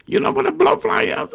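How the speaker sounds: put-on high fast girl voice; girl's voice.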